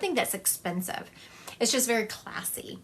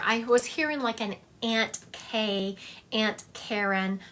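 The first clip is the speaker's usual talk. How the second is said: ordinary talk, nothing marked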